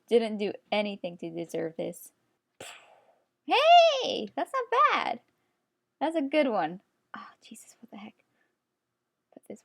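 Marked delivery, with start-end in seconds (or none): 3.5-6.8 much higher, bright squeak of approval; high-pitched pleased "hey"; pleasantly surprised. Real, not acted. Pleased with her drawing. 7.2-9.6 unvoiced whisper, much softer; horrified little whisper; whispered shock. Real, not acted.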